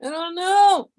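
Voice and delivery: loud, high cry of I don't know; exasperated shout. Real, not acted. Streamer yelling at chat in frustration, loud. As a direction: throw up your hands and yell it.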